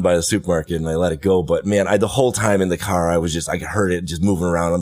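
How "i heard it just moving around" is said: ordinary talk, nothing marked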